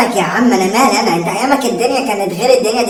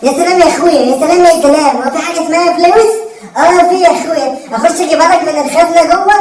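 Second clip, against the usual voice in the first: sarcastic outburst; 3 semitones higher than usual. Acted. Son mocks father about money.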